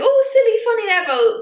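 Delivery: high, mock-cute sing-song; mocking fond voice. Vlogger mocking how the films frame Seamus, in a high mock-cute voice. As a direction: parrot the cutesy framing of a clumsy boy. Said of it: "it's like".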